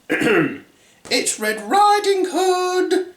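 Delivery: put-on wolf faking a girl's falsetto; very high, drawn-out put-on girly voice. A narrator's reading.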